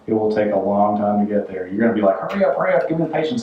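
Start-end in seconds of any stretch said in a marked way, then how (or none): 2.2-3.4 mock impatient. Impatient self wanting patience.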